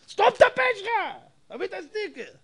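high, loud mock yell; angry shout. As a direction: snap at him furiously. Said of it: "עכשיו הוא כבר צועק".

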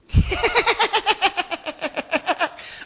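long high mocking cackle; hyena laughter. Preacher mimics hyenas circling the baby elephant, cackling.

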